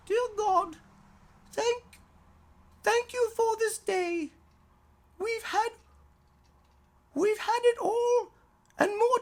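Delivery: put-on high, earnest little girl praying, stumbling and halting; child's prayer. Little daughter saying grace over dinner, high and earnest. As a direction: pray like a small girl at the dinner table.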